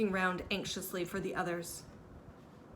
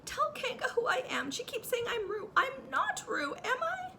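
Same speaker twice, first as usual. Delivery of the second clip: much higher appeal, slowing on not Roo; pleading Piglet. A narrator's reading. Piglet begging Christopher Robin, much higher. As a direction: beg him to tell.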